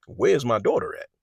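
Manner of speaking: lightly acted mother demanding her daughter's whereabouts. Girlfriend's mother confronting men in her car, demanding.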